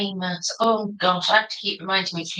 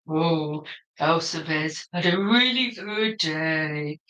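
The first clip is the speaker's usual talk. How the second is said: low, slow dopey bear; 5 semitones lower, much slower than usual. Acted. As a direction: drawl it out like a sleepy bear. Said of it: "which voice to do".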